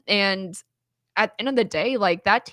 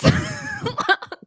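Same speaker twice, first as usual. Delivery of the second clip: burst of laughter; 3 dB louder than usual. Real, not acted. Laughing at the absurd question.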